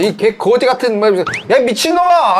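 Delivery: furious insult shouted high; angry yelling. Retold or imitated. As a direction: yell curses at the lawyer. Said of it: "욕을 하면서". (분노한 고함)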